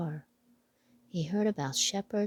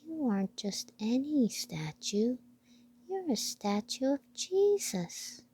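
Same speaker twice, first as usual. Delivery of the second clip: whispered, rising into high mouse voice; a tiny mouse in hushed awe; hushed mouse wonder; awed mouse whisper; 6 semitones higher than usual. Acted.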